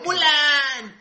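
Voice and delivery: loud, high, drawn-out shout of the name; full-voiced mock cartoon yell; Mushu. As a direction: holler the name like a cartoon character calling out. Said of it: "por como grita [name] al micrófono".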